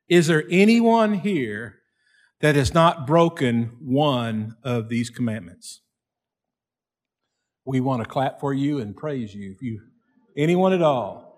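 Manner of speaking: usual speaking voice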